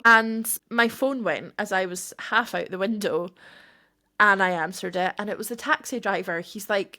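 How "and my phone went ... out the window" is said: usual speaking voice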